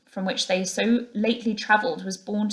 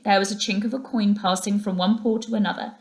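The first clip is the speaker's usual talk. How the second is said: plain reading voice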